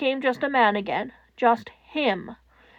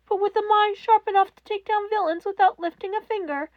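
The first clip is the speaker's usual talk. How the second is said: higher, louder demanding question; furious question; 7 semitones higher, 4 dB louder than usual. A narrator's reading. Netsu confronting Toshinori, high and furious. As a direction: demand an answer he can't give.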